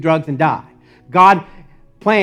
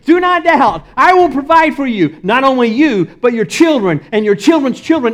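higher, louder, fervent voice of Jesus; voicing Jesus; 9 semitones higher, 8 dB louder than usual. Preacher voicing Jesus to the congregation, lifted and loud. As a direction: proclaim it as Jesus would, full force. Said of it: "you can almost hear Jesus saying these words".

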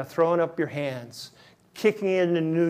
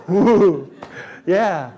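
ordinary delivery

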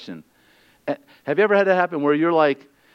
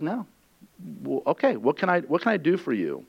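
softer, yielding and kind; gentle, patient; 7 dB quieter than usual. Acted.

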